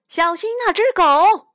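a bystander crying out in alarm; loud yell. A narrator's reading. Someone at the dog show yelling a warning about the runaway dog, loud and high. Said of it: "有人大叫".

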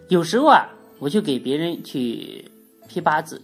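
ordinary talk

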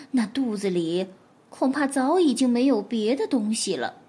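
ordinary delivery

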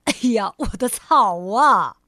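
loud wail of dismay over the grass; anguished cry. Acted. Mr. Fussy crying out over his flattened lawn, loud wail. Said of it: "挑剔先生叫了起来".

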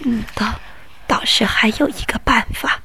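soft, hushed inner thought; grandma quietly plotting to herself; thinking to herself. A narrator's reading. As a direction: murmur it as a private idea. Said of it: "奶奶只有自己想".

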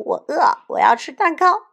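very loud, high mock bawling; wailing cry. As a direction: bawl like a hungry child throwing a fit. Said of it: "大灰狼嚎啕大哭". (夸张地嚎啕哭喊)

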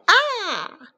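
sudden shrill high cry of fright; startled high scream. Acted. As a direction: yelp as a trident flies past. Trident nearly hits Berio.

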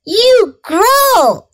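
high-pitched mild yell; startled yell. A narrator's reading. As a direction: cry it out high in surprise. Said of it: "I yelled".

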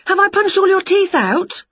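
a fretful witch gasp; worried witch. Acted. Witch worried she punched her cat, high voice. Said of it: "said Winnie".

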